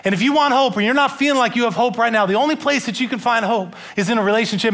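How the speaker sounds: Rodney preaching to a man in his cell, fervent. Retold or imitated. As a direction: preach hope straight at the inmate. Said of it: "Rodney's just preaching".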